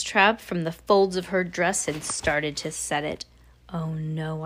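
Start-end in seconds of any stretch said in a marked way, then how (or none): 3.7-4.4 low-key, softer inner voice; hushed dismay. Acted. Boy narrator dreading the mousetrap, soft hushed dismay. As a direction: think it aloud softly, sinking.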